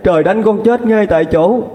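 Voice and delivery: very high, tearful swearing; a desperate daughter swearing to heaven before her mother; anguished oath. Acted. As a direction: swear it as if your life depends on it.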